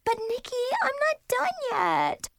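put-on very high little-kid pleading; whiny protest. Little sister begs big sister to continue, whining. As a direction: whine high, stretching the complaint.

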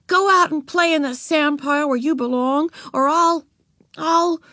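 high bossy order stalling out; faltering threat. A narrator's reading. Beezus threatens Ramona without a plan, faltering order. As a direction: grope frantically for a threat. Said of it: "Frantically, Beezus tried to think".

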